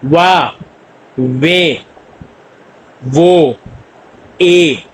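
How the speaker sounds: very slow, stretched ए ये वा वो वी उई; teacher sounding out Korean diphthongs; double vowel drill. Shown as a demonstration. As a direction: model each diphthong slowly for practice. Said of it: "तो आप इसको practice करें".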